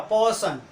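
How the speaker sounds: "पर्सन" as paw-sun, no R; "पर्सन" with silent R. Shown as a demonstration. Teacher models British word, "पर्सन" without R. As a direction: open first vowel in "पर्सन", straight into s. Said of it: "इसका उच्चारण क्या होगा".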